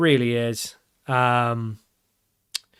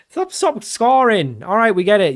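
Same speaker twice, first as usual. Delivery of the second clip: exasperated protest; 7 semitones higher, 4 dB louder than usual. Real, not acted. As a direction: beg them to stop, fed up. Rival scores yet another goal.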